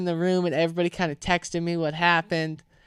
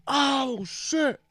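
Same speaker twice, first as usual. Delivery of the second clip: higher, drawn-out "oh shit"; dismayed realization; 7 semitones higher, 3 dB quieter than usual. Retold or imitated. Onlooker reacting to a girl losing it, dismayed. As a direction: recall a hushed, high-pitched uh-oh.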